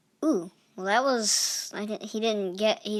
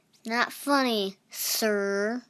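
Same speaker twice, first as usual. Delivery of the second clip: normal talking voice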